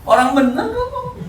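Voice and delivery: very high-pitched mock protest; protes bernada tinggi. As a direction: pitch leaps way up in exaggerated questioning. Acted protest over being angry. (protes pura-pura dengan suara melengking tinggi)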